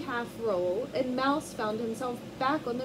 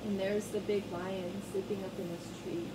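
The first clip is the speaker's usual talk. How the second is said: usual speaking voice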